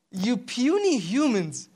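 slow, drawn-out contempt; lofty, lingering mockery; mocking. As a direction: stretch PUNY HUMANS out with scorn. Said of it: "way of mocking them".

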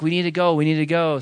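high-pitched, insistent mimicry; imitated boyfriend. Boyfriend pulling girlfriend away, high and insistent. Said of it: "he's like".

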